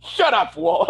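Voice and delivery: retold exasperated outburst; mock angry yell. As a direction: imitate someone cutting him off. Retold group interrupting Walt.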